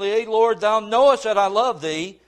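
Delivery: loud, fervent declaration; fervent reply. Retold or imitated. Peter answering Jesus on the beach, loud and fervent. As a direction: answer like a man proving his love.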